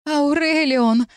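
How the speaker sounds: high, drawn-out call of the name; crying out. A narrator's reading. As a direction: call out for him in alarm. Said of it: "крикнула я". (отчаянный крик, высоко)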